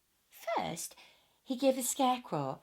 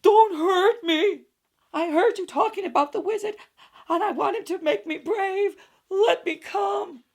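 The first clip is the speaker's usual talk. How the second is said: high, loud frightened pleading; cowardly lion pleading with the friends; 10 semitones higher, 12 dB louder than usual. Acted. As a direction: beg not to be hurt.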